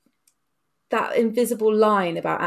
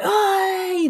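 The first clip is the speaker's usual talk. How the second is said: mock delighted "ah"; 12 semitones higher, 3 dB louder than usual. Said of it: "So they're like".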